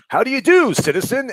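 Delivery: high, cheery hero impression; Batman sixty-six. Host mimics campy Batman greeting a citizen, mock-heroic. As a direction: greet a citizen as campy old-time Batman, pitched well up. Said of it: "like, Batman sixty-six".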